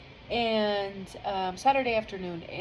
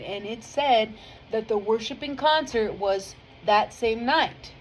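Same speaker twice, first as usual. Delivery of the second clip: ordinary delivery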